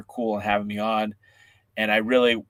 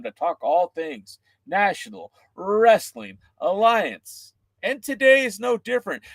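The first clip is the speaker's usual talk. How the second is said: slow, high host pitch; upbeat wrestling podcast host read; podcast host intro; 8 semitones higher than usual. Acted. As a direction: sell the show to the fans.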